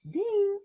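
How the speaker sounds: high bright ding of a kitchen timer. Acted. Narrator imitating the oven timer with a high ding. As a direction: sing out ding like a bell.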